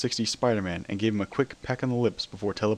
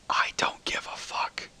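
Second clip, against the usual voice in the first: slow, breathy whisper in her ear; 4 dB quieter, slower than usual. A narrator's reading. Spider-Man whispers into Celestia's ear. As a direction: lean in and whisper it. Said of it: "whispered five little words".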